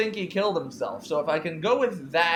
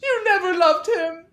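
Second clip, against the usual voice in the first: much higher, much louder accusing scream with a cry; Clarissa screaming; 9 dB louder than usual. Acted. Grieving Clarissa accuses Veronica through the locked door, screaming. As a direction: hurl it at her like she murdered him.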